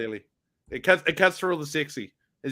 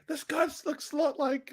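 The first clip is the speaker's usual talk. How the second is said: mock wife voice; 11 semitones higher, 6 dB quieter than usual. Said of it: "she just flicked it through going".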